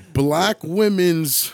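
normal talking voice

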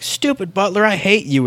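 a hint of a spoiled child's outburst; lightly voiced bratty kid. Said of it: "abused by the kid".

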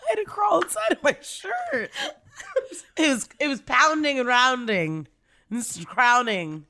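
high, near-tearful laughter, barely speaking; laughing hard. Real, not acted. Cohost can't stop laughing at the recap. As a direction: laugh so hard the words squeak. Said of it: "I can't even speak".

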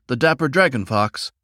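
usual speaking voice

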